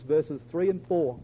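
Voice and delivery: normal talking voice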